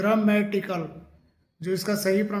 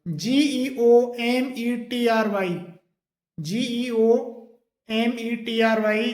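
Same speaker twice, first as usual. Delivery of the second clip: spelling out letters; much slower than usual. Shown as a demonstration. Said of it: "एक और word है".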